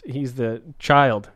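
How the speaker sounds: normal talking voice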